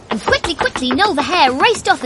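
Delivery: the hare dashing away; excited burst. A narrator's reading. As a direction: rush it with energy. Hare races off.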